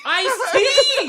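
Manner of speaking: gleeful yelled answer; laughing shout. Real, not acted. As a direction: shout it out, cracking up. Friend yells the answer, laughing.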